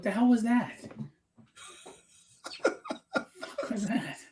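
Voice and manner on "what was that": laughing through the question, slowly; real laughter. Real, not acted. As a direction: ask it while cracking up. Cracking up at friend's odd accent.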